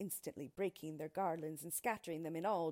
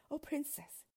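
high, soft appeal to a princess; a gentle, high-pitched cry of appeal; softly imploring lover; pleading cry; 8 semitones higher, 5 dB quieter than usual. A narrator's reading. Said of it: "he cried".

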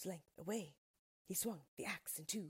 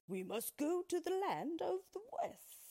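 much higher, louder determined Lion; a hint of a lion rallying his friends; 12 semitones higher, 5 dB louder than usual. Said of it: "said the Lion".

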